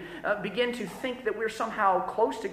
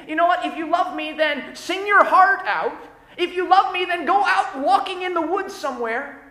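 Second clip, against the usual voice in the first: higher, louder, breezy mock version of Jesus; mock misquote; 7 semitones higher, 5 dB louder than usual. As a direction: toss it off as the words Jesus never said. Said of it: "Jesus didn't say".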